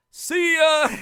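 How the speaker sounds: high, loud, hasty "see ya" as he bolts; panicked yelled goodbye. Retold or imitated. Man fleeing her son's room, yelling a hasty goodbye. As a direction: fling it over your shoulder while running.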